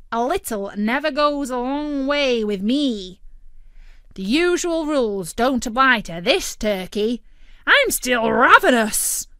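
a smug food critic turkey; Tick-Tock Turkey's voice. A narrator's reading.

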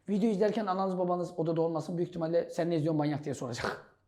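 soft, low and fast; low aside. Real, not acted. Teacher warns students about parents nearby, quiet quick aside. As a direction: slip it in quickly under your voice.

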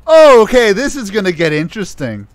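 mock-intrigued, leaping up then settling; intrigued retort. Host retells his reply to the insult, mock-intrigued.

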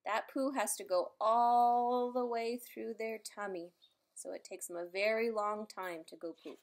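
usual speaking voice

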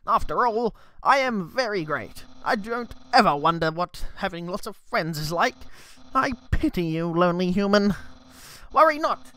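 put-on a light British accent on the skeleton's voice; British-tinged Papyrus. Papyrus pities the lonely human in a mild British accent. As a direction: let a mild British accent creep into the boast. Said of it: "It's British now".

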